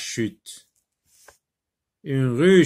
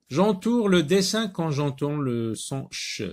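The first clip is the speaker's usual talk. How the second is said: clear, raised reading of the task; instruction read aloud; 4 semitones higher, 3 dB louder than usual. Shown as a demonstration. Teacher reading the instruction to pupils, clear and raised. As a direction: announce the exercise to the class.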